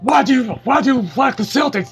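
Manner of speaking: high, outraged mock rant; high mock outrage, rising to a shout; imitating an angry guy. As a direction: ham up an offended stranger's tirade. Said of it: "God bless his angry soul".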